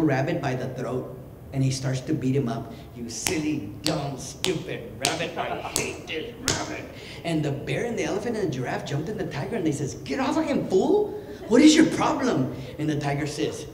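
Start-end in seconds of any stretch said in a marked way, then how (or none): 3.0-6.8 mock angry tiger. Tiger beating up the rabbit. 10.0-12.5 high-pitched indignant outburst; acted protest. Animals pull tiger off rabbit.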